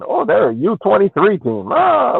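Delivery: ordinary delivery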